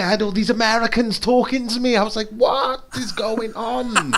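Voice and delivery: high-pitched, mock-bewildered astonishment; retold amazement. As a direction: relive being blown away, voice way up high. Retelling being amazed by online gaming.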